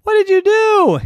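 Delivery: put-on very high, loud kid's disbelief; aghast outcry. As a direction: demand it in shocked alarm. Jackson shocked at broken toy.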